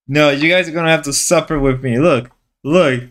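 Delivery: ordinary talk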